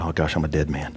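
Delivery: hushed dread. Retold or imitated. Dread after speaking up.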